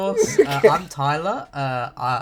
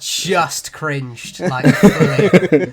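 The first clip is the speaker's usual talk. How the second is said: slow drawn-out cringe; 10 dB louder, much slower than usual. Real, not acted.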